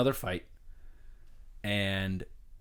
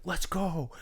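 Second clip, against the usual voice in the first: retold yell; 8 semitones higher than usual. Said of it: "and he's yelling".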